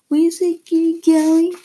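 high, loud mocking sneer; taunting. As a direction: taunt him like a schoolyard bully.

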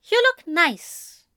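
high, slow angel voice; high-voiced, reluctant compliment; grudging angel. A narrator's reading.

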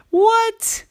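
high-pitched startled yelp; shocked dad. A narrator's reading. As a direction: squeak it out in disbelief. Daddy startled by request.

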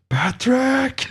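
high-pitched mock shriek of a name; imitated mom's scream. Mom hears porn from the kitchen speaker, imitated scream. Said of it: "and, like, screaming".

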